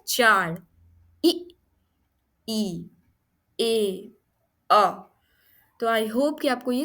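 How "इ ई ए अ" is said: each vowel sound said alone, drawn out: इ, ई, ए, अ; isolated vowel sounds. Shown as a demonstration. Teacher sounding out vowels for students. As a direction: sound out इ, ई, ए, अ one by one. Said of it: "ई के कितने sounds हैं".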